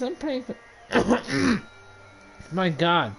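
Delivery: ordinary talk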